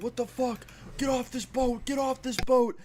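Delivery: high-pitched mock yell, building at the end; retold angry yelling. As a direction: imitate a furious man chasing off intruders. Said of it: "he's yelling".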